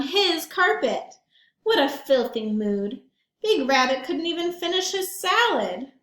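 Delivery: put-on lively read-aloud for kids; storybook narration. A narrator's reading. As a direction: tell it to the children with relish. Reads picture book aloud.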